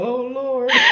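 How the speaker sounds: high, laughing groan of embarrassment; embarrassed, cracking up; laughing dismay. Real, not acted. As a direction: cry it out through laughter. Laughing at own slip.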